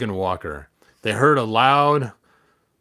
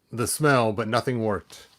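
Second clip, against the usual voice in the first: usual speaking voice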